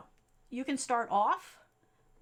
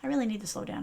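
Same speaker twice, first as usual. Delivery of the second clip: ordinary talk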